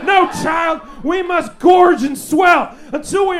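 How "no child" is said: ordinary talk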